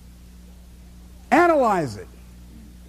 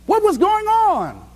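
mock puzzled labelers; 9 semitones higher than usual. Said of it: "they have to ask themselves".

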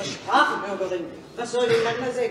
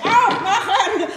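laughing shout, higher and louder; calling out; 8 semitones higher, 4 dB louder than usual. Acted. Mocking the sleepy baker's wife, laughing shout. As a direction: holler with a laugh in it.

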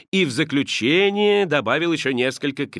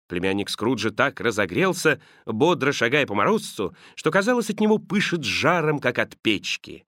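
usual speaking voice

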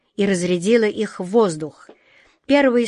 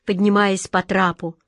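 plain reading voice

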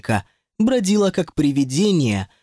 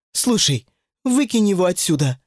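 ordinary delivery

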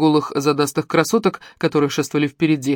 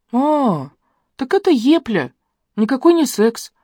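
disappointed; 8 semitones higher, slower than usual. A narrator's reading. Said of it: "разочарованно сказала Лерон".

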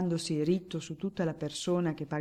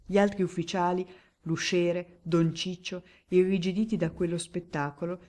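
ordinary delivery

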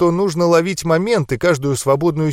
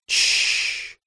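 long, soft, hissed "shhh"; calming voiceless shushing sound; soothing shush; 5 dB quieter than usual. A narrator's reading. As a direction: hush someone gently with a drawn-out hiss. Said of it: "успокаивающе прошипел я".

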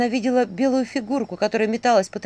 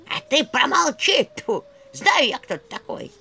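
put-on creaky old woman's voice, loud and slow; скрипучий голос черепа; 6 semitones higher, 5 dB louder than usual. A narrator's reading. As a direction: spit it out like a cranky crone. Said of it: "послышался скрипучий голос". (скрипучий старческий женский голос)

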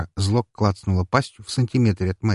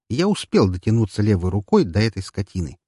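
plain reading voice

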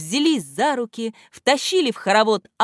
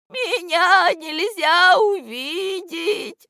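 high, slow, tearful moan; слёзы в голосе; 11 semitones higher, 4 dB louder, much slower than usual. A narrator's reading. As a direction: wail it out plaintively. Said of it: "в голосе у этого невидимого слышались слёзы". (плаксиво, высоко и протяжно)

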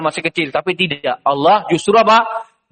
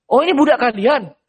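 trader asking; 7 semitones higher than usual. Acted. Trader asks the brothers.

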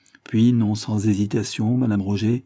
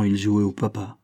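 usual speaking voice

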